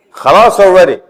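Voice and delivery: exasperated outburst at the mother's calling; fed up. Real, not acted. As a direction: enough already, at the end of patience.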